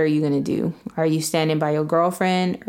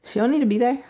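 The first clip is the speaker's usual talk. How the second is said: higher-pitched, needling dismissal; provoking dismissiveness; 6 semitones higher, faster than usual. Real, not acted. Mother dismissing the new girlfriend to her ex, provoking. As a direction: lift the pitch and needle him. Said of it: "the way you just said it".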